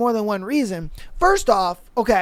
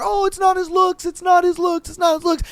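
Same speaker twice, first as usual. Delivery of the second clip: mocking Casey; 7 semitones higher than usual.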